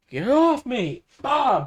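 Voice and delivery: loud high yell while fighting off a hand; pretend fight yell. Fending off the rotting hand, hammed-up high loud yell. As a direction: shove it off with a big shout. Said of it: "No, I'm going".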